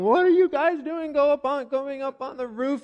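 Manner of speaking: high-pitched mock shout of alarm. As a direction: imitate bystanders shouting up at people, voice pitched high. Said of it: "probably lots of shouting".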